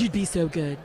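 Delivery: lightly voiced Trump; a hint of Trump's voice; Trump impression. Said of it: "Wow, is that your Trump?".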